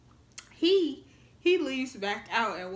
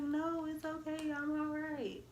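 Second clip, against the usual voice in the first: high, slow reassuring; covering up, pitched up; lightly faked calm; insisting I'm fine; 7 semitones higher, 3 dB quieter, slower than usual. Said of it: "And I'm like".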